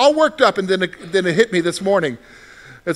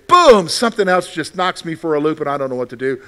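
normal talking voice

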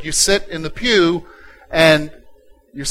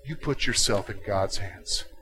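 tender appeal; 4 semitones lower, 12 dB quieter than usual. Acted. Preacher softens to tender appeal.

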